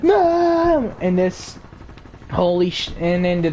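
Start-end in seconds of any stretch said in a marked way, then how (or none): 0.0-0.9 high, slow whining brat calling mom; bratty kid whine. Retold or imitated. Spoiled kid denying, then calling his mom, high whine. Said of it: "He's like".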